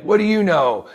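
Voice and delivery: put-on high, loud scoffing old-timer; doubting old client. Eighty-year-old client doubting the young trainer, high loud scoff. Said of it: "someone that's eighty is like".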